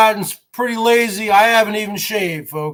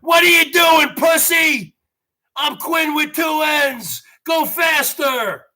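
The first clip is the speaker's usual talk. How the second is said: much higher, shouted trainer abuse; screaming gym trainer; 6 semitones higher, slower than usual. Retold or imitated. Trainer screaming at him on the elliptical machine. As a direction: yell at him like a brutal fitness coach. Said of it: "he gets up in my face and he says".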